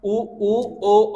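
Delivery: very slow उ, ऊ, ओ, each vowel held with rounded lips; slow vowel demo. Shown as a demonstration. Teacher slowly sounding out rounded vowels for students. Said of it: "जैसे इनसे चार शब्द बोले जाते हैं".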